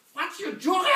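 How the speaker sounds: high, loud mock car navigation voice; shrill GPS voice. Car GPS talking back to the driver, mock high voice. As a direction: play the snooty German GPS. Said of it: "You put on the G P S".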